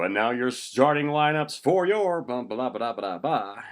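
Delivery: loud, high lineup call, easing off; announcer voice. Acted. Announcer calls starting lineups, announcer voice. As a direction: announce it big, then ease back. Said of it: "it's been my intro".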